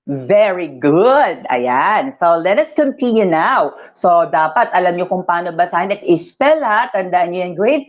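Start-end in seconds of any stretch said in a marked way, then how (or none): none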